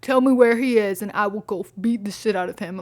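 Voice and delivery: a lightly voiced tough guy; mock macho guy. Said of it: "then he's like".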